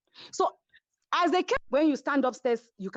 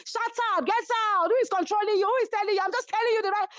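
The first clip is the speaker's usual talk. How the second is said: higher-pitched mock row, snapping at her; imitating angry yelling; 11 semitones higher, faster than usual. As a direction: act out the shouting match, pitched up. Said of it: "that's Chioma's voice".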